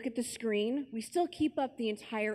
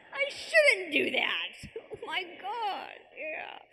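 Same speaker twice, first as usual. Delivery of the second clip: much higher and slow, laughing in hammed-up disbelief; mock shock; 10 semitones higher, slower than usual. Retelling his own reaction to God being right, in a hammed-up shocked voice. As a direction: overplay the shock of realising you're wrong. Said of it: "I'm like".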